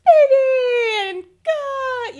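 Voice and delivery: high, loud drawn-out squeals; gushing, stretched-out childlike cries; excited little kid. Acted. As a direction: imitate small kids thrilled to see mom. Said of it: "they're excited".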